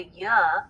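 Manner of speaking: "ya," a y plus a short "uh" vowel; added schwa. Shown as a demonstration. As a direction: say "ya," with a trailing schwa. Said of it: "Y sound switched into".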